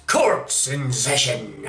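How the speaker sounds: slow, drawn-out mock villain pronouncement; hammed-up slow villain verdict; corny villain line. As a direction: drawl "court's in session" like a ham judge. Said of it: "corny rants about ... courts in session".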